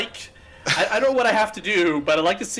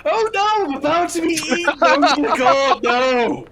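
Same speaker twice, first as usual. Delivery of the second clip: much higher, louder mock terror; hammed-up panic; 11 semitones higher, 7 dB louder than usual. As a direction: shriek like you're about to be eaten. About to be eaten by shark.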